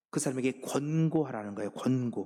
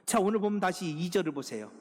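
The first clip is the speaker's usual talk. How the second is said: ordinary delivery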